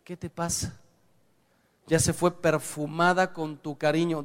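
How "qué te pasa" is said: much softer, gentle loving question; husband answering his angry wife with soft tenderness; tender reply. Shown as a demonstration. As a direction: soothe her quietly, darling.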